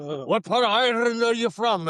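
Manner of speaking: high, loud mock Irish challenge; Irishman's challenge. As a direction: imitate a heated Irish drinker. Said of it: "was actually from Ireland".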